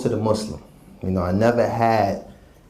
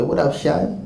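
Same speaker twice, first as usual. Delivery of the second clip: imitating a street greeting; 7 semitones higher, slower than usual. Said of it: "They would try to talk like this".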